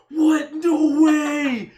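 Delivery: high loud gasp of disbelief; shocked kid. Retold or imitated. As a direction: cry it out, stunned. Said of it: "And we were like".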